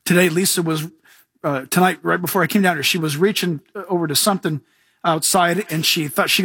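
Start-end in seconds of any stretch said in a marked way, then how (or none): none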